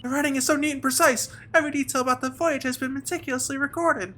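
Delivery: high-pitched, precise assistant voice; polite, admiring assistant. Acted. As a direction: read it as an impressed, proper helper.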